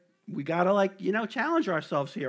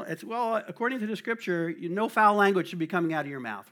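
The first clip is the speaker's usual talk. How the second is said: ordinary delivery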